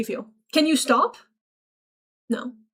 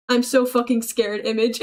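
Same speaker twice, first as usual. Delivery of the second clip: ordinary delivery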